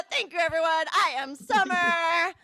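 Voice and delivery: ordinary delivery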